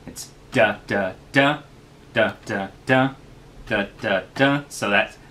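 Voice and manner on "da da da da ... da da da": chanted da da DA beats, third one stressed; rhythmic da-da-DA chant; anapest rhythm demo. Shown as a demonstration. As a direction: tap out the meter on nonsense syllables, leaning on each third da. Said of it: "use the word anapest to get a feel for what it sounds like".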